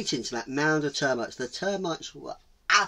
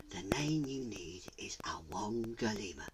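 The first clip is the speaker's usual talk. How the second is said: low, breath-soft secret; hushed, low mystical reveal; whispering spirit; 4 semitones lower, 12 dB quieter than usual. Acted. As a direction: whisper the magic word. Mountain spirits whisper the name.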